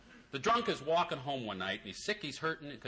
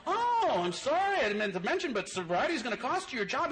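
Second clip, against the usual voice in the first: high, mock-surprised God, overacted; 10 semitones higher, 3 dB louder than usual. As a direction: act out a falsely surprised God, going high. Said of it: "God goes".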